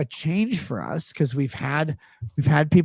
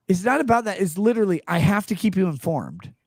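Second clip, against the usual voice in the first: usual speaking voice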